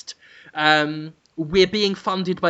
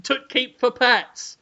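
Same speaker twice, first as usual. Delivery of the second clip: ordinary delivery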